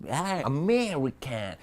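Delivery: high exaggerated "Ah, American"; lightly hammed-up American; mock American. Shown as a demonstration. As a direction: play up a big American "Ah". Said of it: "kind of fast and really".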